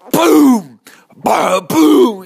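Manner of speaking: loud, high imitated punch impacts; booming punch noises. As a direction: boom out each blow like a sound effect. Imitates big punches landing.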